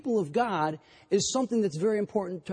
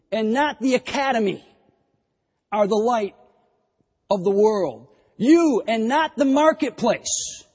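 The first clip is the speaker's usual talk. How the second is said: loud, ringing repetition of "You and no one else"; full-force preaching; 2 semitones higher, 5 dB louder than usual. Real, not acted. Preacher driving home that the church is the light, loudly. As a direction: hammer each "You" home to the congregation.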